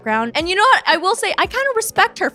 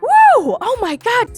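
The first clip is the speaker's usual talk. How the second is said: shocked reaction; 12 semitones higher, 8 dB louder, slower than usual. Retold or imitated. Reacting to the screaming match.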